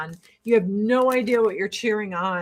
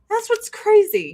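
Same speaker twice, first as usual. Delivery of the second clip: incredulous exclaim; 10 semitones higher, 3 dB louder than usual. Real, not acted. Exclaiming at parents' risk.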